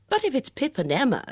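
excited deduction. A narrator's reading. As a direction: jump in eagerly with a theory. Bunch excitedly names suspects.

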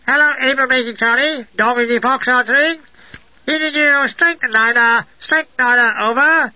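high, put-on voice over a wireless; radio voice. A narrator's reading. Headquarters answering the signal test, crackly-radio imitation. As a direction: imitate a voice coming through a field radio.

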